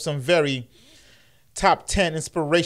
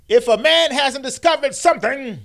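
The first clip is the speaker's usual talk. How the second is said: high, loud preaching cadence; mock King oration, louder and higher; MLK impression; 10 semitones higher, 5 dB louder than usual. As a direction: start the sermon voice big and rising. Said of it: "I gotta get my M L K, uh, lingo on".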